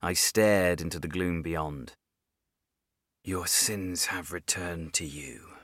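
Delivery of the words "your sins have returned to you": slow, soft, possessed voice. Acted. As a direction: speak as if something else controls you. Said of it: "My mouth, using my voice, but it wasn't me speaking".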